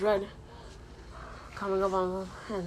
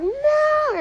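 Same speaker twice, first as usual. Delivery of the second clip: much higher, louder cry of protest; shouted no; 7 dB louder than usual. Acted. Kings cry out to stop their general, shouting. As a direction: yell to stop him before it's too late.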